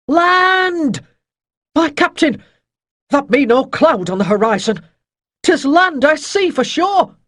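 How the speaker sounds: high, loud sailor's call from the masthead; lookout shout. A narrator's reading. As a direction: holler it down to the captain. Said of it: "then a shout from the sharp-sighted man".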